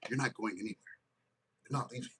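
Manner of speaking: low, soft, ominous; low soft possessive warning; blocking Sag. Retold or imitated. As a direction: block the door with quiet menace. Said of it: "Sag is standing in front of the door".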